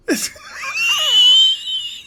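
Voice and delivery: high-pitched burst of laughter; shrieking with laughter; loud laughing shriek. Real, not acted.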